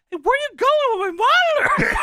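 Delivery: high, squawking call-out; Jerry Lawler. Acted. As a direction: yell after him in a high mock voice. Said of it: "completely calls him out".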